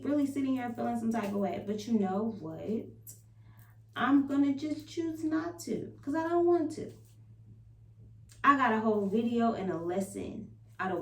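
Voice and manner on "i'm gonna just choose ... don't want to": defiant. Real, not acted. Defiant choice after a bad day.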